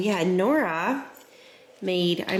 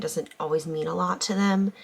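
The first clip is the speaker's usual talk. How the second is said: ordinary delivery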